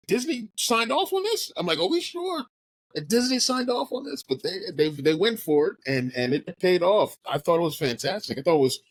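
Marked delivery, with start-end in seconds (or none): 0.1-4.2 disbelief. Retold or imitated. Shocked disbelief at movie.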